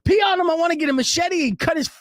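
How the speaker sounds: high, loud incredulous rant; mock-enraged high-pitched disbelief; imitated outraged freak-out. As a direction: act out a furious friend, voice shooting way up. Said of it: "he's freaking out, rightfully so".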